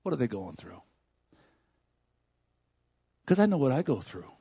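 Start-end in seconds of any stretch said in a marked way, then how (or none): 0.0-0.8 soft, low inner prayer; hushed plea under the breath; quiet inner prayer. Retold or imitated. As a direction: murmur it like a private thought to God. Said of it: "and think".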